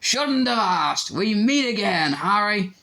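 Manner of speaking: loud, high, hearty and slowed; thundering jovially. A narrator's reading. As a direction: boom it out with a showman's grin. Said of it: "thundered jovially".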